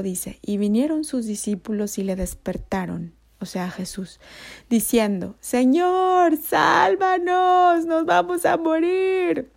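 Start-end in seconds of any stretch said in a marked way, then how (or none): 5.4-9.5 high, loud, slow cry for help; terrified disciples crying out, much higher and louder; panicked plea. Acted. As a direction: beg to be saved as the boat sinks. Frightened disciples begging Jesus.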